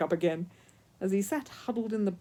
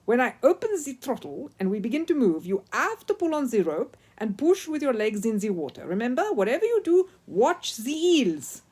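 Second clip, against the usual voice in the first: Italian ski instructor; 4 semitones higher, 7 dB louder than usual. A narrator's reading. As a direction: coach a hopeless pupil in a thick Italian accent. Signor Alberto voiced with Italian accent.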